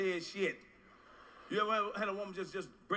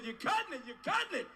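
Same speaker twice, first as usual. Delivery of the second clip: high pained protest; 8 semitones higher than usual. Retold or imitated. To the woman with the cracked tooth, a high pained squeal. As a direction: voice jumps high, pained and laughing. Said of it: "I said".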